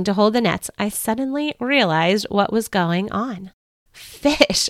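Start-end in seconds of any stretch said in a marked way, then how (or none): 3.9-4.7 a higher cry of amazement; excited cry. Acted. Peter cries out at the huge catch, amazed. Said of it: "I cried".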